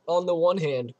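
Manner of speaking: usual speaking voice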